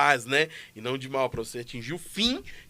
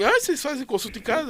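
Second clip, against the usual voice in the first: surprised caller; 9 semitones higher than usual. Retold or imitated. Woman caller surprised, retold.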